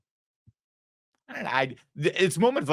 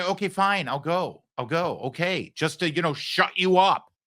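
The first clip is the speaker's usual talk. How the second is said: grudging give-in; 6 semitones higher than usual. Retold or imitated. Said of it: "Wouldn't you say".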